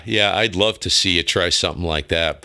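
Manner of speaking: usual speaking voice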